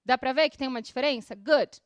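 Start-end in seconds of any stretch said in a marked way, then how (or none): 1.4-1.8 louder, lower one-syllable "Good"; crisp English "Good" in one syllable; soft final D. Shown as a demonstration. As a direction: end "Good" on a light d, no vowel. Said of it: "Esse D, ele é bem suave".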